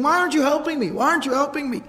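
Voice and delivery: high-pitched pleading, volume held back; retold freaking out. Man begs the family watching him, retold panicked plea. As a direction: imitate someone panicking for help. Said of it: "And he's freaking out".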